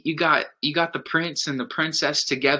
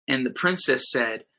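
ordinary talk, nothing marked

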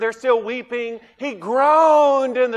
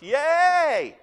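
mock cheer; 7 semitones higher than usual. Mock cheer over verse trivia.

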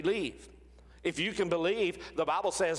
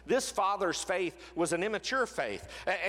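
normal talking voice

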